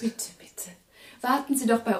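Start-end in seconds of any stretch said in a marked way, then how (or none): 0.0-0.7 soft unvoiced please, please; whispered. Wife whispering to the exhausted professor, soft whisper. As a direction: breathe the words, no voice. Whispered offer to tired guest.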